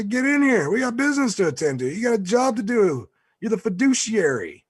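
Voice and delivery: high, loud mock-boss orders; bossy calling out. Boss ordering the fiduciary in to work, bossy mock yell.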